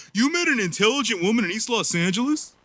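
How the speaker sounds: high, put-on voice of a disbelieving boss; mock the boss's condescending disbelief; imitating an incredulous boss. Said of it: "Asks, incredulous".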